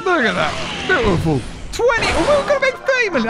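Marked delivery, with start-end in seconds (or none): 1.7-3.3 high, thrilled squeal; excited high squeal. Real, not acted. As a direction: squeal at the score. Celebrating high score.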